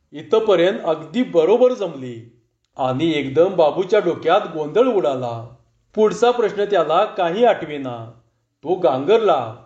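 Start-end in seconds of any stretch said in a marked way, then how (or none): none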